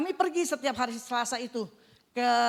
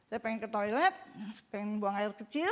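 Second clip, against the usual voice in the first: ordinary delivery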